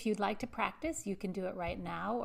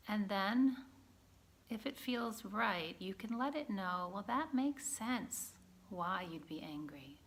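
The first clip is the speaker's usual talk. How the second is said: gentle guided-practice voice with long pauses; guided meditation; 3 dB quieter than usual. Acted. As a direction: guide a listener calmly inward, leaving space between phrases.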